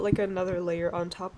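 usual speaking voice

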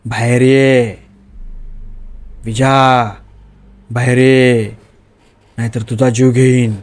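possessed wife calling. Acted. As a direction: call him out like a ghost that wants his life. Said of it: "आणि मला म्हणली".

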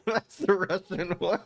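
high, laughing words; helpless amused laughter; cracking up. Real, not acted. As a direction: squeeze it out through laughter. Cracking up at the Russian voice.

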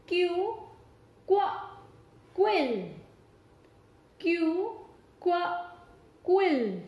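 teacher drilling Q words; phonics word drill. Shown as a demonstration. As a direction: sound out क्यू, क्व, क्वीन for kids. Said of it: "जो इन्हीं letter से start होते हैं और उनकी sounds".

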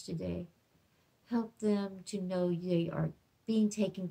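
soft, hushed classroom prayer; gentle prayer. Acted. Teacher praying softly for her kindergartners. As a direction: pray softly over the children.

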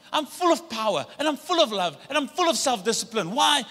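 usual speaking voice

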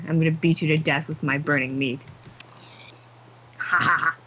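normal talking voice

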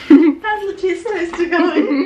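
teasing through laughter; laughing. Real, not acted. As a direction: ask it while laughing.

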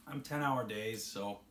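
talking around a cigar; cigar in mouth. Real, not acted. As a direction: lips clamped on cigar. Seen 0: Cigar held at lips throughout the labelled words.

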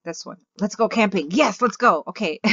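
ordinary talk

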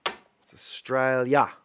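ordinary talk

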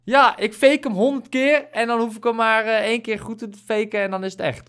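high, loud thrilled gushing; excited. Real, not acted. Gamer still thrilled, telling his friend about the temple. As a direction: keep riding the high of the discovery.

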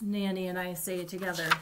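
plain reading voice

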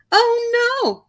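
high alarmed gasp. A narrator's reading. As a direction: gasp it as he slips. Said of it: "gasped Sister".

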